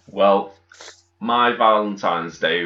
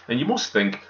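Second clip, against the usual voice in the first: usual speaking voice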